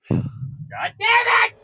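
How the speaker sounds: high, stretched-out shout; furious yell. Acted. Boss yelling after hanging up on his cohost. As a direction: scream it slowly in frustration.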